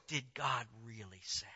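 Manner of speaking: very low, soft insinuating voice; quiet, low voice planting doubt; hushed tempter. Acted. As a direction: drop right down like a secret tempter.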